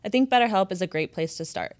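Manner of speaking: loud, upbeat sponsor read. Acted. Host reading the BetterHelp ad to listeners, loud and upbeat. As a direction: sell the sponsor with punchy energy.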